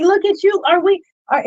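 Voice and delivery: high, loud disbelieving cry; incredulous outburst. Retold or imitated. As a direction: throw up your hands at the hypocrisy.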